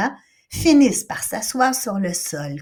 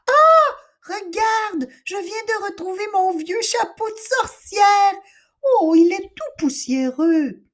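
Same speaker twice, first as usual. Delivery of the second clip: excited find; 13 semitones higher, 4 dB louder than usual. Acted. Sister finds old witch hat.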